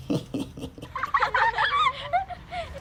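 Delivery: high sneaky cackle; wicked wolf laugh. Acted. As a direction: cackle with glee. Wolf waits in Grandma's bed.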